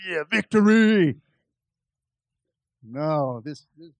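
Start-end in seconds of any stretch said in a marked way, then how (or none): 0.1-1.2 mock triumph. Mock triumph expecting a breakthrough.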